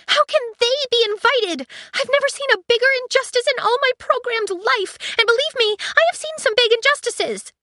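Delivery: high, loud indignant android; high loud protest; outraged ship AI. Acted. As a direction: wail it as an offended AI. Aiko indignant.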